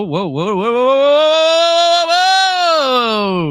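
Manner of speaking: rising, loud, long whoa; hyped whooping. Real, not acted. Streamer spotting a super sticker, excited rising whoop. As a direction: build into a high, sustained holler.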